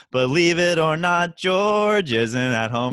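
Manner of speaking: slow, drawn-out answering machine voice; sing-song it like George's machine message; slow mock answering machine greeting. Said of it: "the Seinfeld where George".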